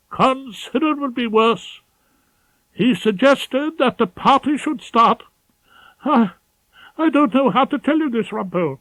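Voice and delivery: a nervous pompous senior barrister with urgent news; fussy, pompous head of chambers. Acted. Ballard's voice.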